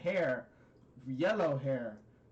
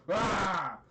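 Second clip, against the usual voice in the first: short, louder cartoon yell; mock yell; 4 semitones higher, 5 dB louder than usual. Brother shows off his hair, mock yell. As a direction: let out a sudden mock battle cry.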